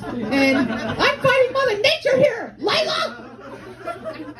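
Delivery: very high, drawn-out mock wail; slow, high comic protest; exasperated wail. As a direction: plead in hammed-up outrage. Wailing protest at her mother.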